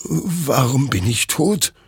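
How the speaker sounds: high, slow, bewildered lament; stunned dismay. A narrator's reading. Hector sees his own body, stunned and plaintive. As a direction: ask it in dazed disbelief.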